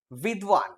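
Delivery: বিদ্বান alone as "vidvan"; demonstrated "vidvan" for বিদ্বান; Sanskrit-style pronunciation. Shown as a demonstration. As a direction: model বিদ্বান with a clear v after the d. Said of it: "এটা উচ্চারণ হওয়া উচিত".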